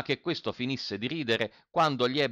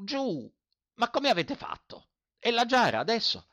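shouting landowner; 9 semitones higher than usual. A narrator's reading. Landowner shouts at the trapped old man to wriggle free, high and flustered. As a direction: bark directions in a panic. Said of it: "gridò al vecchio".

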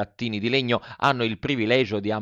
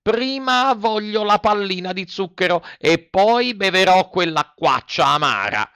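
put-on high-pitched wheedling puppet boy; childish puppet Pinocchio, bargaining; 9 semitones higher, 5 dB louder than usual. Puppet haggles with the fairy over bitter medicine, wheedling. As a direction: bargain in a high little voice, stretching the demand.

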